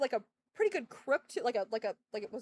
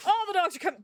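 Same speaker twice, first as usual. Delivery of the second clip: excited alarm; 7 semitones higher, 8 dB louder, faster than usual. Real, not acted. Excited announcement.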